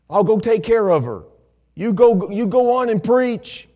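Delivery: high, very loud preaching delivery. Retold or imitated. Preacher quoting son telling dad to go preach, full-force shout. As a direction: retell the son's words at full pulpit volume.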